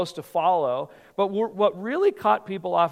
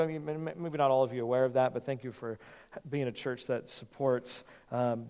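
ordinary delivery

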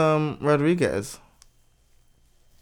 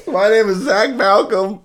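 higher, laughing mock admission; mock serious confession; 7 semitones higher, 3 dB louder than usual. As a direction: fake a grave reveal while cracking up. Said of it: "have a real serious moment".

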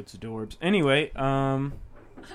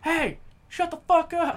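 mock angry shout; 10 semitones higher, slower than usual. Bats yelling at each other.

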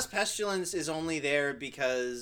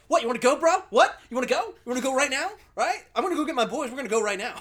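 higher, louder bro daring you to fight; aggressive frat boy; 7 semitones higher, 6 dB louder, faster than usual. Retold or imitated. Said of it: "frat boy that is always like".

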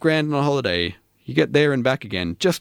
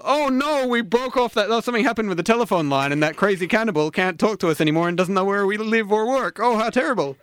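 high-pitched feigned dismay; oh dear, what a tragedy, hammed up; mock horror; 8 semitones higher than usual. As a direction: lament it with exaggerated, insincere alarm. Feigns horror at dropped cannibal call.

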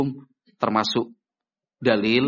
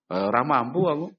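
usual speaking voice

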